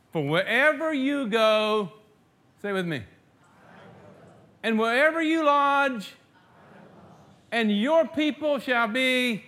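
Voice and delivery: very high, loud, slow pulpit proclamation; preacher leading the church through Ruth's vow; fervent preaching. Acted. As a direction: stretch every phrase like a vow from the pulpit.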